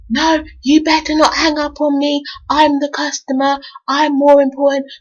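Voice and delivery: hammed-up customer yelling down the line; mock furious customer. Caller on hold loses it at the coworker, mock outraged. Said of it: "she's going nuts, mad on the phone".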